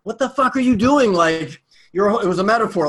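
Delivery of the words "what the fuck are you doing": loud, high exasperated outburst at yourself; retold self-scolding. Driver berating himself for passing the girl, exasperated. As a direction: berate yourself, pitched up and forceful.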